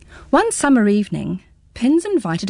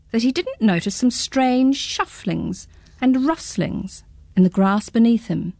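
plain reading voice

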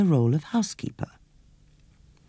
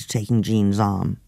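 usual speaking voice

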